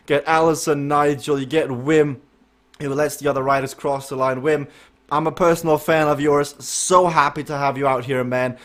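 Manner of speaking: ordinary talk, nothing marked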